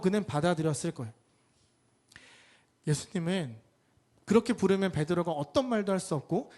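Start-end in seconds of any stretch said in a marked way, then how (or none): none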